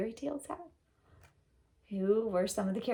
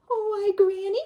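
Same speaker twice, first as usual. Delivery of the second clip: put-on little girl; 13 semitones higher, 7 dB louder than usual. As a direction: sound small and sweet. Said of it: "she said".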